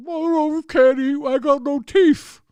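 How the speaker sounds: high, whiny mock voice of toothless bros; hammed-up high toothless whine; toothless gym bros. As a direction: whimper like you've lost all your teeth. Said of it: "And they're like".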